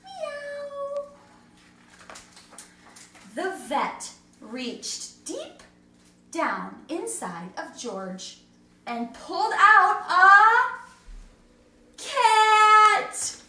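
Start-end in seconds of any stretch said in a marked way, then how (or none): 0.0-1.1 high meow like a cat. Acted. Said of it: "George went". 9.1-13.4 loud, higher astonished reveal; surprised reveal. A narrator's reading.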